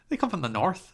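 a light Northern Irish lilt on north, laughing through it; a playful Northern Irish imitation; mock Northern Irish accent. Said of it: "A Northern Irish accent".

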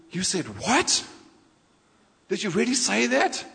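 astonished question with a high rising end; incredulous. Acted. Reader questioning Jesus over his words, incredulous. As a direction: question it in disbelief, pitch climbing on the last word.

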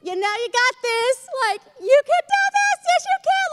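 squealing overexcited encouragement; obnoxious cheering parent. Retold or imitated. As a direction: hype up a baby over the top. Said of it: "obnoxious, extra, extra".

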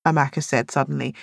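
plain reading voice

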